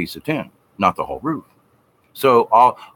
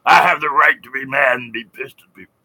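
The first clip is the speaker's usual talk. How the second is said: higher, insistent claim of rights; mock indignant person; 4 semitones higher, 4 dB louder than usual. Mocking angry acquaintance, indignant and insistent. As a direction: insist on your right to be mad.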